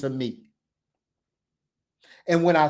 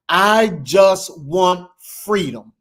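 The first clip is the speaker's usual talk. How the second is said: slow, loud and drawn out, crying out for freedom; loud slow declaration; 2 semitones higher, 7 dB louder, much slower than usual. Real, not acted. Preacher to the crowd, crying out for freedom, loud and slow. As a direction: call it out big and slow, every word held.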